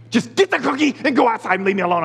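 high, fast, exasperated brush-off; hurried mock exasperation; fed-up mom. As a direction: shoo the kid away, mock mother.

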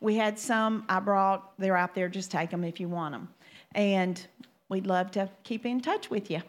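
ordinary delivery

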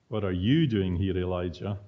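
"you" punched and the rest kept level; stress on YOU. Shown as a demonstration. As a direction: land the weight on "you", as if singling him out. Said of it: "depending on where you put the emphasis".

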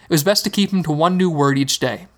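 plain reading voice

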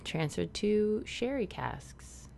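usual speaking voice

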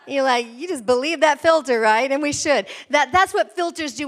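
energetic sermon delivery; preaching at full force. Acted. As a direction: preach it out to the room.

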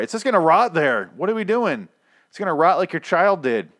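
high, loud mock argument; indignant protest. Arguing with Rick over the rotting tomato, indignant protest.

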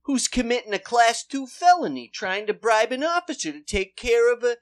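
spring the trap with mock innocence; sly Commish. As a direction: commish calling his officer in on the briber. Commish continues bit.

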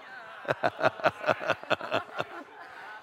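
high, breathy wheezing laugh. Real, not acted. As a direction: keep a long pulsing laugh going. Preacher laughs on after the demonstration.

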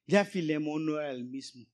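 ordinary delivery

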